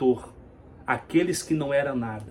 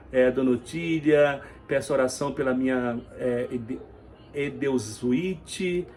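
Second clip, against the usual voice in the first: ordinary delivery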